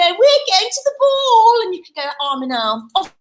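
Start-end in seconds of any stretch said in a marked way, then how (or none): 0.0-1.6 high, loud sing-out; mean ugly sisters. Shown as a demonstration. Storyteller shows children the ugly sisters' line, crowing. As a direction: crow it like a skipping ugly sister. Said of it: "'cause you're mean, could you skip around".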